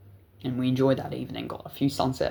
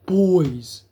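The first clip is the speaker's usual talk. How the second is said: cop calling out; 4 semitones higher, 6 dB louder than usual. Retold or imitated. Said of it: "And he's like".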